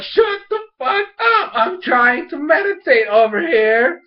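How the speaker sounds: high, drawn-out irritated outburst; Jinora pissed. Retold or imitated. Jinora trying to meditate through bickering, mock pissed-off yell. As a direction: snap it slowly, in mock fury. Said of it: "Jinora's, like, pissed".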